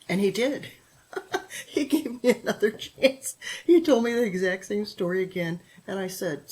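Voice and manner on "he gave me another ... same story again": emotional laugh. Real, not acted. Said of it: "getting me emotional".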